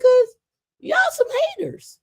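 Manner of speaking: squeaky high "haters" jab; very high mocking taunt. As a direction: tease them in a high squeal. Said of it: "I was like".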